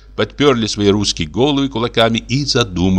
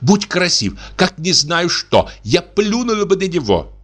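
high, indignant tirade; indignant wife; 7 semitones higher than usual. Acted. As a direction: rant in outrage. Wife indignant about scoundrel.

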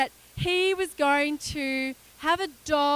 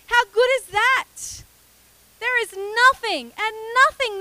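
preaching; 3 semitones higher, 4 dB louder than usual. Acted. Preaching to the congregation.

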